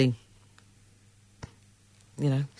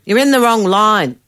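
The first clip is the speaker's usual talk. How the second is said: raised voice, higher pitch; loud retold repeat; 6 semitones higher, 7 dB louder than usual. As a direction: say it out loud for all to hear. Repeats whispered line out loud.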